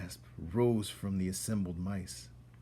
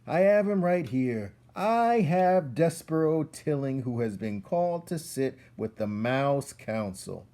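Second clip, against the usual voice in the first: louder, higher herald's proclamation; loud announcing; 7 semitones higher, 7 dB louder than usual. Acted. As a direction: announce him to the whole hall. Furlow announcing loudly.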